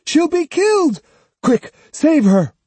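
very high, loud panicked scream. Acted. Bystander in the crowd screaming for help, high and loud. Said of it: "someone screamed".

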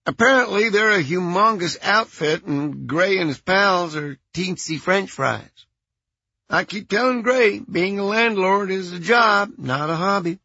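airy, unbothered phone voice; Merrill's voice. A narrator's reading. Voicing Merrill.

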